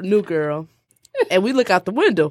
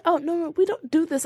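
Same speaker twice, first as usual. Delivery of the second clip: high, mock-dainty protest; prissy and proper; 6 semitones higher, 4 dB quieter than usual. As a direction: imitate a very proper lady objecting. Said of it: "we acting like British women".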